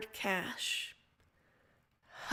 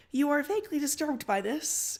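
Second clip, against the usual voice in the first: plain reading voice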